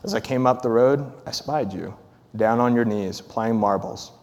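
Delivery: earnest read-aloud of a father's letter; story reading. Acted. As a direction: read it like a father confessing to his son.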